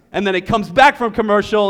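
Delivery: usual speaking voice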